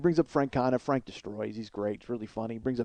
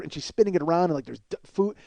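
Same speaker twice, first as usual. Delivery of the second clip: usual speaking voice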